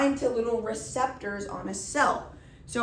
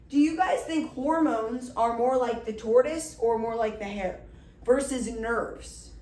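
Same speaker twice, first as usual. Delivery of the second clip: ordinary delivery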